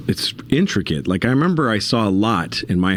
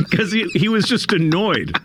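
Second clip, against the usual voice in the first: laughing; 12 semitones higher than usual. Real, not acted. Laughing at his own story.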